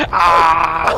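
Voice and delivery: a short loud "Ah!" blurted out; mock yell. Laughing friend voicing the snake running through the sewer, mock yell. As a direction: play the snake yelping as it runs.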